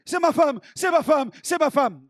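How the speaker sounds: loud, high, breathless repetition; overwhelmed husband. Acted. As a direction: blurt it out again and again, overcome. Weeping husband repeats his answer.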